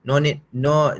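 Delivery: usual speaking voice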